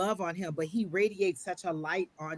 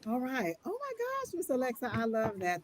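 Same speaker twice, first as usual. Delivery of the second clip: much higher burst of glee easing down; real delight, much higher at first; delighted gush; 7 semitones higher than usual. Real, not acted. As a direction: squeal it, then settle. Delighted reaction to the reading.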